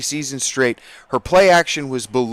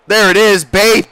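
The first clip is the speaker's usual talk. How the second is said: loud, high shout of triumph; celebratory shout; 6 semitones higher, 7 dB louder than usual. Real, not acted. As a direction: yell it out as the play lands. Celebrating a big play.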